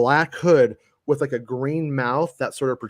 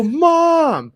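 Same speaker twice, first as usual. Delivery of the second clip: calling mom; 9 dB louder than usual. Retold or imitated.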